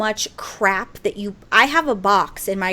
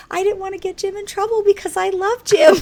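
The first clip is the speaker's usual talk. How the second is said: high pleading husband; whiny husband voice; 11 semitones higher, 4 dB louder than usual. Retold or imitated. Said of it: "And he goes".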